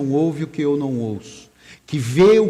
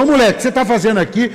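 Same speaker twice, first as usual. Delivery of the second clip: loud, high, rapid mock rebuke; quick, raised-voice imitation of scolding; acted scolding; 6 semitones higher, 6 dB louder, much faster than usual. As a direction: tear into the kid as an older brother. Older brother scolding David in story.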